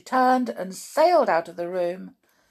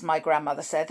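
plain reading voice

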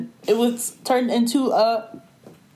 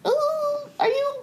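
high squeal of mock doubt, half laughing; mock hesitant surprise; slower than usual. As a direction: act out the "should we?" moment in a high squeak. Friends exchanging looks, accepting ride.

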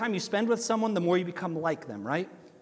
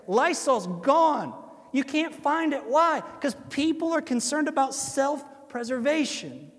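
rising preacher's fervour, higher; preaching; 6 semitones higher than usual. Acted. Pastor urging the congregation, rising preaching fervour. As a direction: drive the point home from the pulpit.

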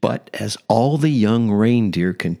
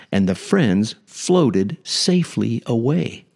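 ordinary delivery, nothing marked

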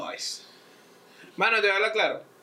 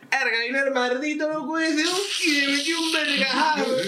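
put-on high, loud Margarita local girl; 11 semitones higher, 5 dB louder than usual. Telling her boyfriend about the attack, shrill and loud. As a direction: squeal it like a tough island girl. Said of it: "que tú le digas".

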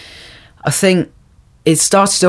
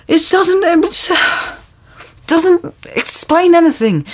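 mocking voice; 13 semitones higher, slower than usual. Mocking the film's characters.

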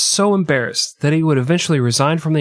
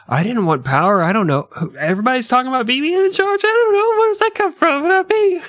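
mock innocent Gates; 12 semitones higher than usual. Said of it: "He'd be like".

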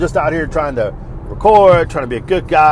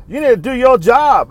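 high, loud, insistent order; acted-out indignant demand; imitated bossy shopper; 8 semitones higher, 5 dB louder than usual. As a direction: mimic a customer laying down the law.

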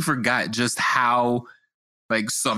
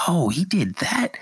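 higher-pitched impressed reaction; amazed viewer; 7 semitones higher than usual. Retold or imitated. Host acts out catching nuances in a great performance, amazed. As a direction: imitate a fan spotting a new detail. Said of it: "where you're like".

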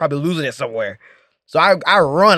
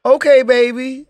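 put-on higher, drawn-out "okay, baby"; dancer's quote; 8 semitones higher, 4 dB louder, slower than usual. Dancer agreeing to the customer, higher drawn-out coo. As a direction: slowly coo it, pitched up. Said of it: "she was like".

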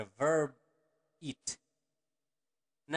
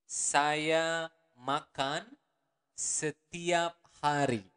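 each word of Saya makan setiap hari slow and spaced; much slower than usual. Shown as a demonstration. Teacher to learners, slow model sentence. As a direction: spell the sentence out slowly. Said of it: "Or the normal speed would be".

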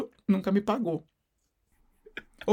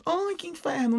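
higher and louder, fed-up groan of a cry; exasperated outburst; 9 semitones higher, 7 dB louder, much slower than usual. Retold or imitated. Fed up with the guy who keeps coming back, exasperated outburst. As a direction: throw up your hands at the pest. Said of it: "Eu disse".

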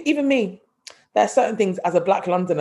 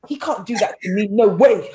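[name] as road man; 4 semitones higher, 5 dB louder than usual. Acted. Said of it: "She's a road man".

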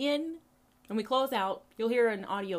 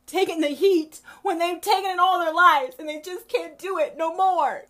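higher and louder, building passion; impassioned cry; 8 semitones higher, 8 dB louder than usual. Retold or imitated. Mama defending the community, higher and louder. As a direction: preach it out with rising fire. Quoting mama's impassioned speech.

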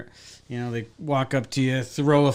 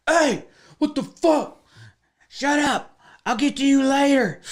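gruff cook; 13 semitones higher, 3 dB louder than usual. Retold or imitated. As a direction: bark it like an annoyed cook in the back. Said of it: "the cook in the back's like".

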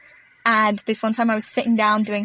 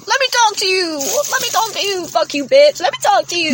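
frantic yelling; 7 semitones higher than usual. Retold or imitated. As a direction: yell it through a window. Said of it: "banging on a window going".

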